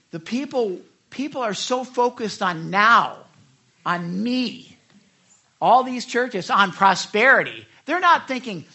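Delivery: ordinary delivery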